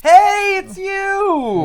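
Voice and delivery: mock cheery greeting. Joking along with the Joker story, high mock-cheery greeting. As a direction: chirp it like a delighted old friend.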